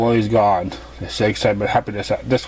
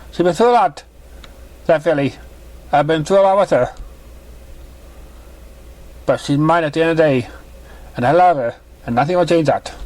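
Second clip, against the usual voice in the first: higher, gentle mother's voice; motherly mare voice; 5 semitones higher than usual. Acted. Mother greeting her daughter's new guest, higher voice. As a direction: play the kind mother pony.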